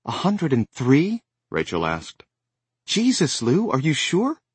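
high, loud alarmed question; shocked mother's disbelief. Acted. Alarmed mother hears fever.

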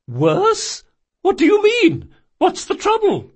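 very high, startled questions; alarmed old woman. Acted. Old shopkeeper hearing of trouble, high alarmed questioning. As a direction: demand the bad news in alarm.

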